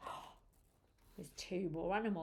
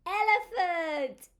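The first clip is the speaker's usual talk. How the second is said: high, sung-out announcement; raised pitch, falling at the end; excited reveal; 7 semitones higher, 4 dB louder than usual. Acted. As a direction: call out the animal with delight. Revealing the next animal.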